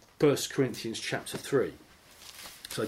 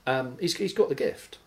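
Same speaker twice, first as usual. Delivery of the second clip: mock reverence; 6 semitones higher than usual. Mocking the priest's credentials.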